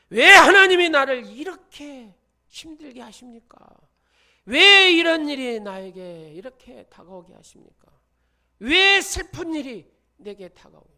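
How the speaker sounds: high, loud anguished wail at God; complaining to God. Retold or imitated. Believer blames God for hardship, wailing loudly. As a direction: cry out accusingly as a suffering believer. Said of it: "이렇게 원망부터 한다는 겁니다".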